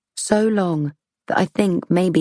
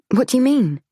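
alarmed; 8 semitones higher than usual. Acted. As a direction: ask it with a chill of fear. Chilled narrator asks again.

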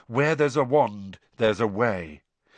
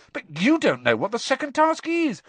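puzzled Harry; 12 semitones higher than usual. Acted.

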